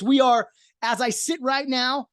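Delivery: ordinary talk, nothing marked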